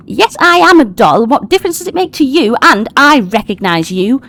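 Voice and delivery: high, loud doll voice; high-pitched talking doll; indignant doll. Acted. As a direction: pipe up defiantly in a high voice. Voicing doll character, defiant reply.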